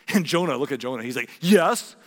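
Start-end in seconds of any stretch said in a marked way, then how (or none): 1.4-1.9 loud, defiant answer; angry retort. A narrator's reading. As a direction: fire it back as angry Jonah. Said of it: "he retorted".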